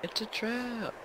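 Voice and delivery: mock dramatic warning. Joking about suspicious key.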